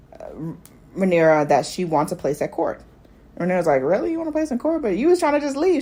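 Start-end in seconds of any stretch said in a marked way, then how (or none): 3.8-5.8 incredulous question. Retold or imitated. Rhaenyra questioning the girl.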